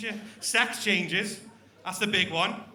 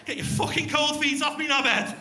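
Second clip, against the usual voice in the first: mock annoyed snap; 5 semitones higher, 5 dB louder than usual. Girlfriend snapping at partner.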